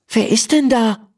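put-on a girl calls out at the empty door; Emma calling out. A narrator's reading. Girl asks who knocked at the stable, puzzled. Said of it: "Emma calls out".

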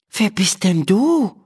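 high, amazed question; astonished. A narrator's reading. Emma meets a gnome-sized man at the door, astonished. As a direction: ask in wonder, voice lifted well up. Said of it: "fragt Emma erstaunt".

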